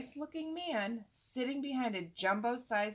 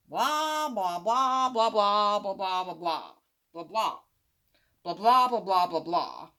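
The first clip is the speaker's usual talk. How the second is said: louder mocking blah blah chant; bored droning; 8 dB louder than usual. Boy mocking a boring book, loud bored chanting. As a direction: drone it like the dullest book.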